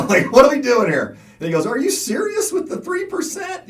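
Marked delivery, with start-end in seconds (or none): 0.1-1.2 loud, high-pitched disbelief; incredulous retort. Retold or imitated. Acted indignant reply to laughing broker. 1.7-3.7 high, loud mock disbelief; scoffing broker imitation. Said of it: "He's kinda just kinda laughing it off".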